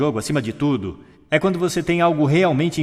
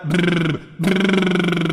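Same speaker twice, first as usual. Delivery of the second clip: "brrr" agudo e forte, sustentado; vibração de lábios; 6 semitones higher, 5 dB louder than usual. Shown as a demonstration. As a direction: vibre os lábios como criança brincando. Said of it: "igual quando você era criança". (trinado labial longo, "brrr")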